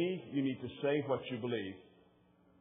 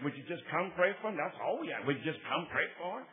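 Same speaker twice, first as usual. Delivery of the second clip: Martha; 4 semitones higher, 4 dB louder than usual. Retold or imitated. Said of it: "Martha was talking to him".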